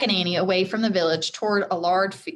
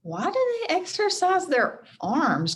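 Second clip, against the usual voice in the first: Jack wondering; 8 semitones higher than usual. A narrator's reading. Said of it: "Jack wondered".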